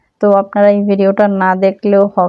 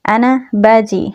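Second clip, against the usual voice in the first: slow careful Arabic; slower than usual. Shown as a demonstration. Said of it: "এরকমভাবে বলবো যে".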